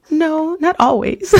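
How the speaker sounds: high, drawn-out tearful whine, played for laughs; exaggerated weepy voice, slow and high; mock crying. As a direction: imitate yourself blubbering on the phone. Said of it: "I'm crying. I start crying on the phone".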